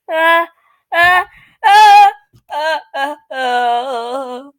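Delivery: repeated grunts of unease; creeped-out uhs. Acted. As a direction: stammer in mounting alarm, then subside. Creeped out by talking walls.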